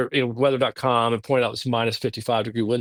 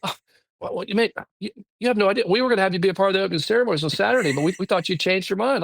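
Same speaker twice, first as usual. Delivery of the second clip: higher and rushed, thrilled at the surprise; excited; 8 semitones higher, faster than usual. Retold or imitated. Curler Pete recognising the visitor from South Carolina, excitedly. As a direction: gush with delighted disbelief, a lot higher. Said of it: "he's like".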